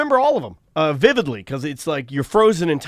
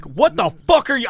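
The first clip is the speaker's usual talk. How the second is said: high-pitched outraged retelling; going nuts; 9 semitones higher than usual. Retold or imitated. Confronting the driver who nearly hit him, retold angry outburst. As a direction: re-enact losing it at a driver. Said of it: "And I went nuts".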